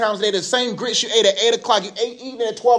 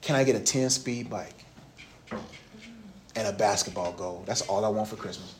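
put-on child asking mom softly; 11 semitones lower, 9 dB quieter than usual. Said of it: "I asked my mom".

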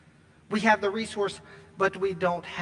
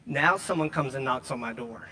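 ordinary talk, nothing marked

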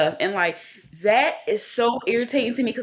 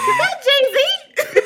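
squealing, much higher and louder, slow and laughing; shocked; 5 dB louder, much slower than usual. Retold or imitated. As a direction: gasp it out in disbelief. Shock retelling [name] appearing.

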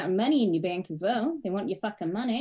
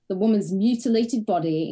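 ordinary talk, nothing marked

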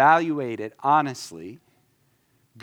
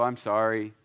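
curt sorry; 3 semitones lower than usual. Shown as a demonstration. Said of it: "Or you can go".

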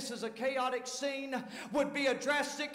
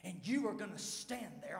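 low, hushed scolding, bitten off; softer stern command; quiet stern rebuke; 5 semitones lower, 6 dB quieter than usual. Retold or imitated. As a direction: tell them to shut up in a quiet hard voice. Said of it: "the anger of God's come on him".

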